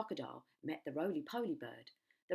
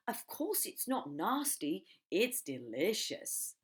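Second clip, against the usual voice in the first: slow, savouring every word, louder; slow relish; 4 semitones higher, 5 dB louder, slower than usual. Acted. Crocodile savours the thought of eating children, slow and gloating. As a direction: drawl it out like tasting food.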